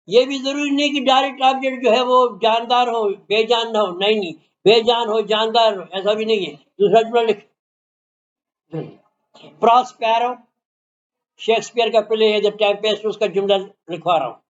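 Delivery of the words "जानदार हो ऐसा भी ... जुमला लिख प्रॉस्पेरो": normal talking voice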